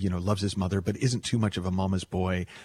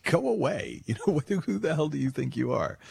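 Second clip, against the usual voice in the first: higher, laughing mock outrage; mock indignant brush-off; 8 semitones higher than usual. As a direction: shoo him off, half laughing. Refusing the abusive pizza guy.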